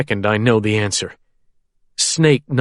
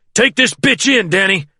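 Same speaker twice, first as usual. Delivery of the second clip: urgent shout; 8 semitones higher than usual. A narrator's reading. Carl urging pilot.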